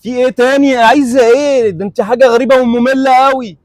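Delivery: screaming at a pestering woman; furious shouting. Acted. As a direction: blow up at her in rage. Man blows up at the woman.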